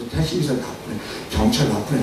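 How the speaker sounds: low, soft visitors' tutting; hushed acted commiseration; sympathetic murmur. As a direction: agree quietly to soothe the patient.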